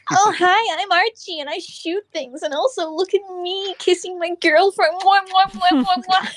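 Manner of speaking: very high, hammed-up mocking voice; Archie. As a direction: gush in a squeaky parody with exaggerated kisses. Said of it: "here's my impression of Archie".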